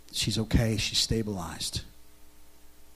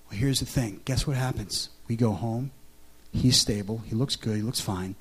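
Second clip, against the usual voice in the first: usual speaking voice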